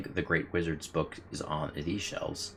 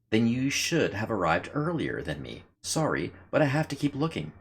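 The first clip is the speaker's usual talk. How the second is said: firefly voice; 6 semitones higher than usual. Acted. Voicing the firefly's refusal.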